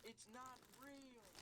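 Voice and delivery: put-on cranky old ranger. Ranger denying creepypasta.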